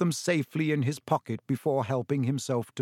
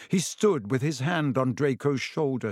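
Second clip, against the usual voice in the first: plain reading voice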